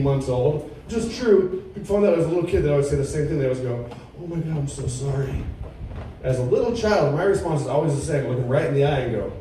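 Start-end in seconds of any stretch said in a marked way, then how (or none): 4.2-5.4 hushed, imitated sympathy; soft mock-sorry murmur; mock condolence. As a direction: softly offer overdone condolences. Imitating people offering condolences.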